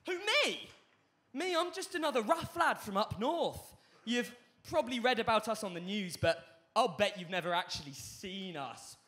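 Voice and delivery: high, loud theatrical lad; hammed-up drama monologue. Rough lad from up north addresses audience, hammed-up monologue. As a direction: leap up high on the question, then declaim. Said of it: "perfect G C S E drama monologue".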